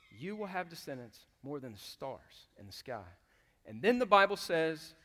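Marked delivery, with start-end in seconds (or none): 0.1-3.0 quiet God voice. Retold or imitated. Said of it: "he says to them".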